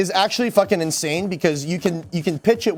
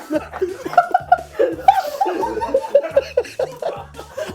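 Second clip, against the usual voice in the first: long, high, helpless laughing fit; hysterical laughter. Real, not acted. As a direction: laugh until you can't stop.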